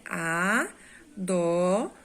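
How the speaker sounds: "Adoro" stretched very slowly, syllable by syllable; syllables drawn out. Shown as a demonstration. Teacher shows the pupils the three syllables, very slowly. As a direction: draw out "Adoro" so each syllable stands apart. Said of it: "A palavra adoro é trissílaba".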